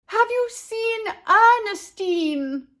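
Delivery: high, slow loud yell; mother yelling over tuba horns. Acted. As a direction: shout it over blaring horns. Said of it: "yelled over the blaring horns".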